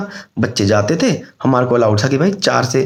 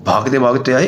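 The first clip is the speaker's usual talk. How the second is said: ordinary talk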